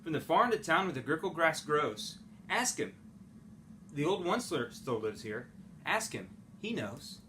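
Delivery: plain reading voice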